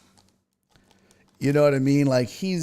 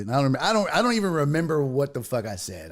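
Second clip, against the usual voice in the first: ordinary talk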